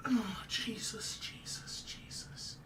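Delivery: whispered, slow pleas; a broken whispered prayer; grieving whisper. Real, not acted. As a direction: breathe the words out in sorrow. Praying in grief.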